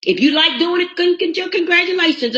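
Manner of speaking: high, sarcastic cheer; mock congratulations. Host teases callers happy with their lives, high mock cheer.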